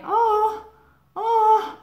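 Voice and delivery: high, loud, hollow fake "Oh, oh"; mock a hammed-up sympathetic "Oh"; fake sympathy. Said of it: "with such a fake intonation".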